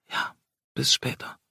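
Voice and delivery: soft, voiceless whisper; hushed farewell whisper. A narrator's reading. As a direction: whisper it back against his lips. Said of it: "flüsterte sie zurück".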